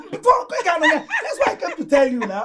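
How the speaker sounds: loud, high, laughing exclamation; excited. Acted. Sisters celebrate good news.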